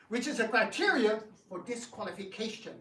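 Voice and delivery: ordinary talk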